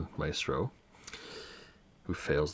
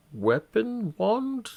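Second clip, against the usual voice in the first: rising uncertainty; 5 dB louder, much slower than usual. Real, not acted. Searching for the right word.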